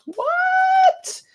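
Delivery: genuinely floored by what you see; shocked what. Real, not acted. Shocked at the card spread.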